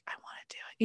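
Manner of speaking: soft unvoiced whisper, almost secret; whispered wish. To herself about the pricey jacket, whispered. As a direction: whisper it like a guilty temptation.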